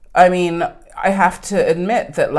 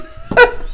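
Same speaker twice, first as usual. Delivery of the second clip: high laugh; 5 dB louder than usual. Real, not acted. Laughing at the memory.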